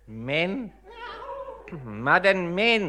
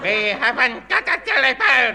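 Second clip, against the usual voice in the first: laughing through the line; 7 semitones higher, 4 dB louder than usual. Acted. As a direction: burst out laughing. Laughs at the request.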